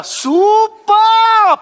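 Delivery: long stretched super, high and loud; drawn-out admiring super. Acted. Photographer gushing over his subject, stretched super. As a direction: savour the word like a delighted artist.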